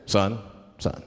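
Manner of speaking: low-pitched stern cop voice; deep, measured officer at a traffic stop; police officer. Acted. Policeman questioning a driver pulled over, low officer voice. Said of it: "the police pull me over".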